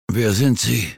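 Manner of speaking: a steady, firm question despite fear; surprisingly firm. A narrator's reading. Old man demands the stranger's name, firmly. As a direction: keep the voice firm, hide the fear. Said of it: "klang seine Stimme erstaunlich fest".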